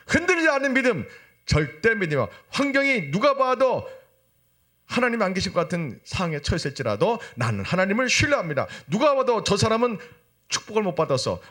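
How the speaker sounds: high, insistent sermon list; impassioned preaching. Acted. Preacher lists hardships that test faith, impassioned preaching. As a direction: drive each hardship home on a high pitch.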